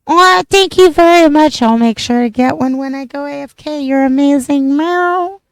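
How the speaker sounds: high, sweet, bubbly kawaii voice; squeaky cute anime girl; cute anime streamer. Acted. Cute voice thanking chat.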